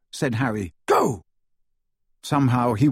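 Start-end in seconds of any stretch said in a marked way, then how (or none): 0.9-1.2 short high urgent call; sharp raised-voice command. A narrator's reading. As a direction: order them to move, pitch raised. Ordering friends to run in battle.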